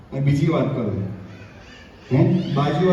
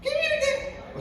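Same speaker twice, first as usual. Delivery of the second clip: a mock listener piping up; high squeaky interjection; 9 dB quieter, much slower than usual. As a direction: squeak the question very high and soft.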